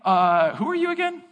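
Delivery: high, baffled who-are-you voice; puzzled stranger. Retold or imitated. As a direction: play a stranger who can't place you. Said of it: "he doesn't sit there and say".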